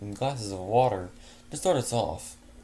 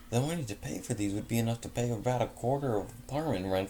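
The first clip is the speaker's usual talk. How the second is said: plain reading voice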